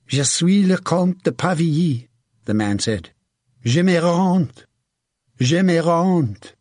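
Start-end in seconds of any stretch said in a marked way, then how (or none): 0.1-2.1 high, urgent French voice; pitched-up plea of a captured noble; French captive. A narrator's reading. French captive gives his name. 3.6-6.5 high, slow, urgent French cry; drawn-out, pitched-up plea; French captive surrendering. A narrator's reading. French captive surrenders to his captor.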